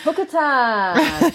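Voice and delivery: high, slow, drawn-out echo; playful echo. Real, not acted. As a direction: stretch it out gleefully. Echoing hookah time, amused.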